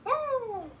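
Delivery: woo. Real, not acted. Reacting to a crude chat message.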